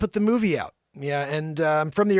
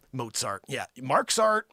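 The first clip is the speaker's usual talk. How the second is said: usual speaking voice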